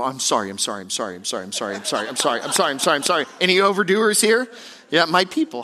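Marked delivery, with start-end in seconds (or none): 0.0-3.3 overdone apologizing. Shown as a demonstration. Preacher mimics an over-apologizer, frantic repeated sorries. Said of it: "Some of us overdo sorry".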